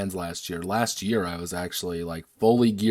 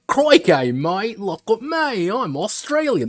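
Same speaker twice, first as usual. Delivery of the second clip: loud, high, drawn-out bad Australian accent; terrible Australian accent; 12 semitones higher, 6 dB louder, slower than usual. Mocking what people expect from someone claiming to be Australian, terrible Australian accent. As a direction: do a badly faked, over-the-top Aussie shout. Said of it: "That's a terrible fucking accent".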